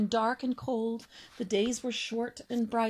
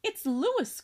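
higher, louder glad exclamation; joyful cry; 8 semitones higher, 4 dB louder than usual. Acted. Father swan recognizes his son, crying out in joy. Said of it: "cried this ho-, the cob".

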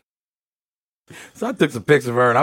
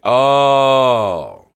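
long, loud, held-out Oh; louder, lingering reaction; drawn-out oh; 6 dB louder than usual. Retold or imitated. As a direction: stretch out a big knowing oh. Reacts in retold dialogue.

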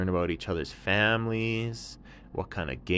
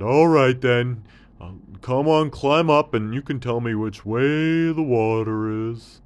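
lightly voiced surprised camel, louder; a thirsty camel perking up; hint of a thirsty camel; 5 semitones higher, 10 dB louder than usual.

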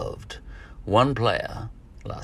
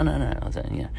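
ordinary talk, nothing marked

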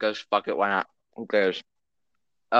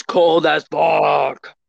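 drawn-out shout; 3 semitones higher, 6 dB louder, much slower than usual. Pretend. Podcast curse punchline.

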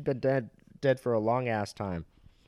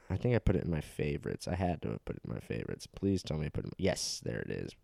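mumbled to himself; 7 semitones lower, 11 dB quieter, faster than usual. Real, not acted. Mumbling while scrolling for a text.

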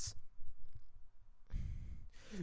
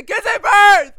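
much higher, much louder yell; outraged shout; 13 semitones higher, 17 dB louder than usual. Real, not acted. Vlogger yelling at the movie in disbelief. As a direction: scream it in disbelief.

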